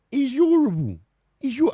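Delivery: high, loud, hammed-up Cockney; bad Cockney accent. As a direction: push the mock Cockney right over the top. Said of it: "I can't do a Cockney accent".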